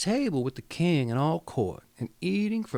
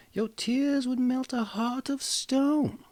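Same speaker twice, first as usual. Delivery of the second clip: much higher, slow, strange voice from the well; mysterious frog voice; 10 semitones higher than usual. Acted. Frog calling to the weeping princess, high and slow. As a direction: let it float up from the water, slow and high. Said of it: "she heard a voice saying to her".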